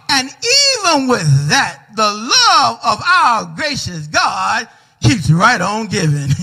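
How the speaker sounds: ordinary talk, nothing marked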